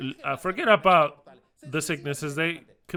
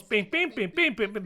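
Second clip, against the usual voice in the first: higher, chirpy phone pings; phone ping imitation; 6 semitones higher than usual. Ranting speaker mimics phone notifications to listeners, chirpy pings.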